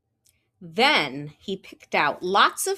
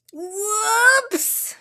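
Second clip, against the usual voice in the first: high, loud cry; cried whoops; 12 semitones higher, 4 dB louder than usual. Acted. Froggy sees the librarian staring, high whoops. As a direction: caught red-handed. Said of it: "cried Froggy".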